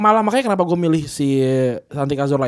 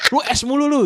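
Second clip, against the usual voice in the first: higher nagging mom voice; mock scolding mom; 10 semitones higher than usual. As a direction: nag like a mom, lightly. Mother scolding in retold story.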